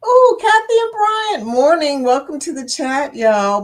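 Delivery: high, loud welcome; excited greeting. Real, not acted. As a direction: greet them like long-lost friends. Greeting chat members loudly.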